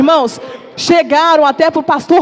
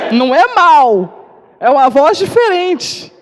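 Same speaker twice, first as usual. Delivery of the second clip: loud, slow, stretched-out repeat of the husband; hammed-up emphatic repeat; 3 semitones higher, 4 dB louder, slower than usual. She mockingly repeats her husband's excuse, loud and slow. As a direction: drag out "Não é mal" loudly, half laughing.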